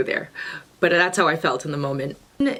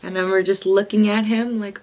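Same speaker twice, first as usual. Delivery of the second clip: ordinary delivery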